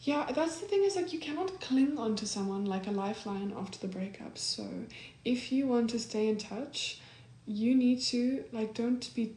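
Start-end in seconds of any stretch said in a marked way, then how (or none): none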